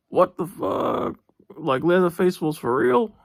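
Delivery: high, slow, stunned "what the fuck"; shocked disbelief. Retold or imitated. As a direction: recreate the moment the penny dropped, stretching it out. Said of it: "That's what I was thinking".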